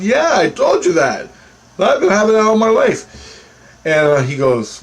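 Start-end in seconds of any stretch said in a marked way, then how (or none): 0.0-3.4 high, insistent retold outburst; exasperated agreement. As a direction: insist you said so all along. Acting out exasperated vindication.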